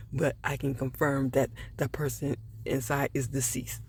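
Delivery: soft, half-whispered examiner voice; imitated hushed medical examiner; imitating hushed official. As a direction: mimic his hushed solemn delivery. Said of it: "Why is he talking like that?".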